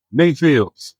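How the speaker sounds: loud, high call of a name across a distance. Retold or imitated. A man spotting an old friend calls out his name. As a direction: shout the name to get his attention.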